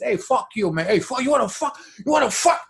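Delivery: mock angry challenge, climbing in pitch; Al Pacino. Quoting Scarface's cursing for laughs, Pacino impression. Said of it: "hearing Al Pacino".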